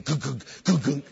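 low, soft thumping heartbeat noises; imitated heartbeat. [name] mimicking his nervous heartbeat, soft low thumps. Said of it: "My heart's beating".

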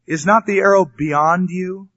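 slow, loud call across the field; cried out. Acted. Jonathan calling to the running lad, loud and slow. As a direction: call out after the lad, drawing it out. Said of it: "Jonathan cried out after the lad".

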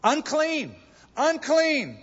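high, loud leper's cry of warning; yelling Unclean. Retold or imitated. Loud shout of Unclean. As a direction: leper should have cried out to warn. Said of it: "what should he have said?".

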